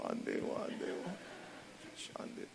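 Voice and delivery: fading whispered pleading; boy's sobbing plea fading to a whisper; whispered sob. Retold or imitated. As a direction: let the plea die to a whisper.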